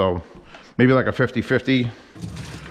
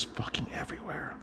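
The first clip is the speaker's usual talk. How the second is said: whispered aside; 3 semitones higher, 19 dB quieter than usual. Real, not acted. Aside while praising a clean kitchen.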